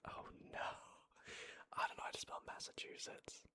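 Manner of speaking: usual speaking voice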